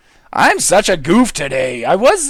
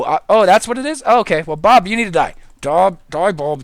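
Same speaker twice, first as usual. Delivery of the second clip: usual speaking voice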